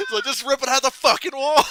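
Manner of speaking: high, loud yell through laughter; angry Bernie. Retold or imitated. Imitating Bernie still raging at the phone, laughing shout. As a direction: keep up mock rage while cracking up. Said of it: "Angry Bernie's".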